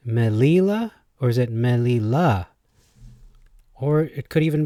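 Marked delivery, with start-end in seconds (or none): 0.1-0.9 "Melila" with LI high; meh-LEE-la?; stress on middle syllable. Shown as a demonstration. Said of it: "put a different emphasis on words". 1.5-2.5 "Melila" with LA punched, then falling; meh-lee-LAH; stress on last syllable. Shown as a demonstration. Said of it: "Emphasis on the last".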